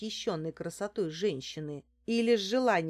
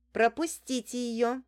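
higher, firm order to the guard; demanding; 5 semitones higher, 4 dB louder than usual. A narrator's reading. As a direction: insist she be let through. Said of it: "потребовала Лариса". (требовательно)

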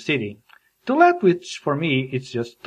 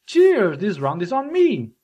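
Cheers flung up high, then settling; hammed-up toast that starts very high; mock toasting; 5 semitones higher than usual. Thanking someone for carrying help, mock pub toast. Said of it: "they're toasting".

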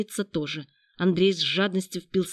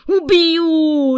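high, very loud roar of rage; drawn-out furious scream; wild howl; 10 semitones higher, 12 dB louder than usual. A narrator's reading. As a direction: howl the threat in pain. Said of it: "Робинзон дико взвыл".

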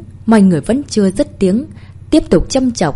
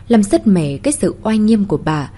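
ordinary delivery, nothing marked

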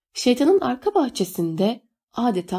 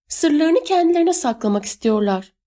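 high-pitched, contained narrated shout; 8 semitones higher than usual. A narrator's reading. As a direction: call out the accusation, pitch raised. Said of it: "diye bağırdı yüzgeçli oğlanlardan biri". (tiz sesle, hafifçe bağırarak)

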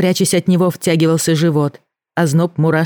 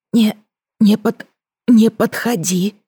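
hoarse frightened stammer, higher; wheezy voice; 6 semitones higher than usual. Acted. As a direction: wheeze out a broken warning. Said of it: "просипела Леся". (сиплый испуганный голос)

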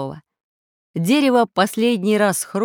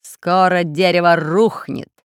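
slow, gloating villain voice; 5 dB louder than usual. Acted. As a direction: savour each word with malice. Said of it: "сообщил злорадствуя".

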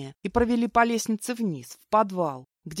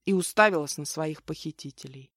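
usual speaking voice

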